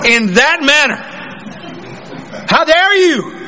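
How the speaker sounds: insulted patient. Acted. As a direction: act deeply offended, building from measured affront to haughty protest. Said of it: "then you being insulted".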